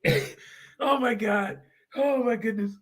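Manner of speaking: high voice through laughter; laughing. Real, not acted. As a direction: gasp it out, still cracking up. Still in laughing fit.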